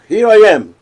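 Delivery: loud, high, ready announcement; bold answer. Acted. How Abraham does not answer God, bold and eager. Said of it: "He doesn't say".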